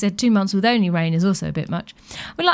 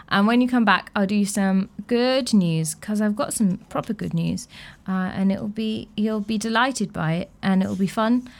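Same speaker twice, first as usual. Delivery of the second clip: ordinary talk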